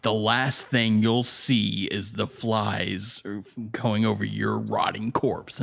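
dry, over-enunciated intimidation; slow, precise mock threat; John Malkovich. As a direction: slowly pick out each word as a menace. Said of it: "he's very enunciating all of his intimidations".

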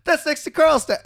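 very high, loud delight; retold excitement. Swede learning they are neighbours, imitated thrilled shout.

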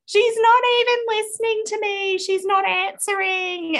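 put-on child complaining sister ignores her; imitating a frustrated child. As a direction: wail it like an ignored little girl.

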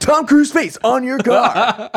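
high, slow laughing ad voice; radio ad announcer. Pretend. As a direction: stretch it out like a hype ad. Continuing the mock ad.

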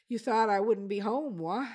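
put-on Newfoundland accent. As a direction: tease him in Newfoundland accent. Old man teases intruder.

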